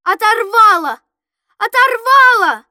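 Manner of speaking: loud repeated cries of alarm; high, loud shouts across the ice; panicked shouting. A narrator's reading. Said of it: "бегали по льдине и кричали".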